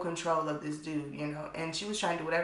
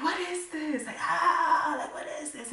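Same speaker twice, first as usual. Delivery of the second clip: much higher, strained cry of panic; panicked pain; 9 semitones higher, 3 dB louder than usual. Retold or imitated. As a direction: shriek at the sudden pain. Lucius in pain, music torture.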